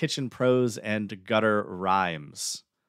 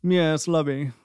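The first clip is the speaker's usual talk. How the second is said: put-on stuck-up British accent; 6 semitones higher, slower than usual. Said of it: "that is my, uh, British".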